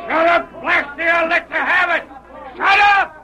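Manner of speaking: high, loud threatening shout; angry yell. Acted. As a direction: shout them down with menace. Scout yells at prisoners.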